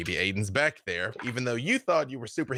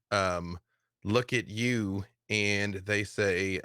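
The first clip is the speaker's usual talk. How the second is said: ordinary talk, nothing marked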